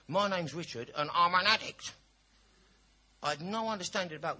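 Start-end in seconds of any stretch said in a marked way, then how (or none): 0.1-2.0 loud, slow, high mechanical repeat; hammed-up rote meeting introduction; parroted recitation. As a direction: chant it out like a parrot, loudly. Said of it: "I repeated like a parrot".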